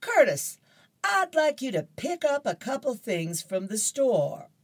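a mother calling her son; mom voice. Acted. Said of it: "Mom says to Curtis".

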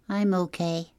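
small, high gosling voice; high and subdued young bird; little gosling. A narrator's reading. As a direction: answer in a little high-pitched child creature's voice. Upset gosling answers his mother.

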